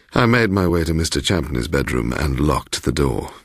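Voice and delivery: ordinary delivery, nothing marked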